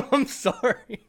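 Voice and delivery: high-pitched apology through real laughter; guilty, giggly sorry; helpless laughing. Real, not acted. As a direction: apologize while cracking up. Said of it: "I can't believe I'm laughing".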